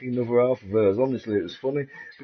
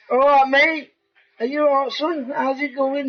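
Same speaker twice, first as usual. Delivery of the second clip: cheery, higher and louder greeting; sarcastic chirpy hello to a jealous husband; mock friendly; 12 semitones higher, 3 dB louder than usual. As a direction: wind him up with a chirpy hello.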